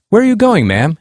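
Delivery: a narrator's mild mock shout; continued shouting. A narrator's reading. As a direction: call after her insistently, hammed up a little. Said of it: "he continued shouting".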